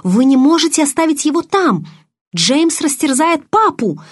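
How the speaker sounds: высокий отчаянный крик; кричи, умоляя спасти отца. A narrator's reading. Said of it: "закричала я". (отчаянно крича)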